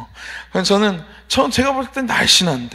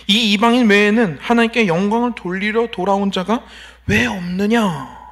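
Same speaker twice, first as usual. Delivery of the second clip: questioning Jesus. Retold or imitated. Jesus questioning the one who returned, wondering. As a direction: ask it searchingly, slowing on the final question. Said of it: "이렇게 의문을 가지십니다".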